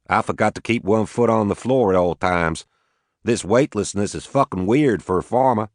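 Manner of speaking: put-on easygoing Arkansas farmer, Southern accent. Max to the Watanabes, a light Southern accent. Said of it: "He had a heavy southern accent".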